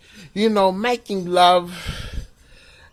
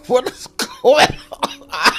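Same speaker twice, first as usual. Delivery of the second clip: laughing shock; 8 semitones higher, slower than usual. Acted. Shocked reaction in the story.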